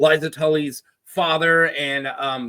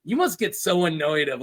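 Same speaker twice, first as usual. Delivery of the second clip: normal talking voice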